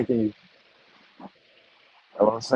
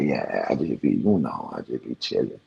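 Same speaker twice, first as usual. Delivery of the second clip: nonchalant son; 9 semitones lower, 5 dB quieter than usual. Retold or imitated. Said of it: "he just nonchalant".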